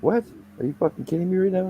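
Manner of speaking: high, quick incredulous retort; mock disbelief. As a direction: retell it in high-pitched, rapid disbelief. Retold disbelief at boss's order.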